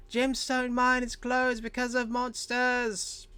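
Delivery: high, drawn-out crier's yell; kid yelling out. Acted. Kid heard by passing adventurers, yelling out. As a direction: shout the announcement across the street. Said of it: "You hear him again kinda yell out".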